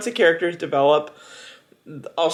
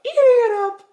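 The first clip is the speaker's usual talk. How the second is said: much higher, giddy; excited squeal; 3 dB louder than usual. Real, not acted. Reader raving about the book, high giddy squeal. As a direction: squeal it with glee.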